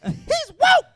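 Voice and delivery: high, mocking laughing parody; mock him with a squeal. Mocking the same impression.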